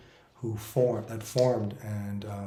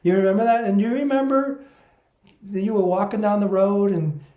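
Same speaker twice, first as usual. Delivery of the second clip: higher, louder playful spirit; humorous spirit voice; 5 semitones higher, 7 dB louder than usual. Retold or imitated. Spirit guides recalling his boyhood wish, high playful voice. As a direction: tease him about his childhood dream. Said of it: "the way they speak is humorous".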